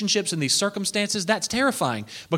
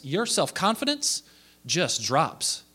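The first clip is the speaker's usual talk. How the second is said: normal talking voice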